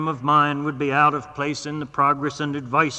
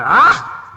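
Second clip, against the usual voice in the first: a raised, louder contemptuous "Ah!" like hawking to spit; 7 semitones higher, 9 dB louder than usual. Acted. Doctor spitting scorn on the graduate school, a hawking "Ah!". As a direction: bark it as if clearing to spit. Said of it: "as if hawking to spit".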